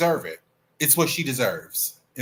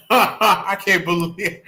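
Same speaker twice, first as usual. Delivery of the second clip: ordinary delivery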